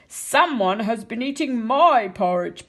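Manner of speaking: Papa Bear rumbling about his porridge in a great big voice. A narrator's reading. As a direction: boom it out like a big bear father. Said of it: "said in a great big voice".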